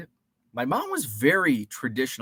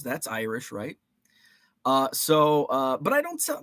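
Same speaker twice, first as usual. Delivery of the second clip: ordinary delivery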